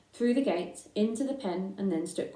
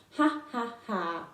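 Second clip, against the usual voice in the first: bleating laugh; 3 semitones higher, slower than usual. Acted. A big lamb mocks Babe, bleating ha ha. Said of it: "bleated a big lamb".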